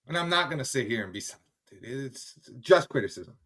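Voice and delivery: usual speaking voice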